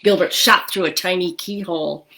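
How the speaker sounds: ordinary delivery, nothing marked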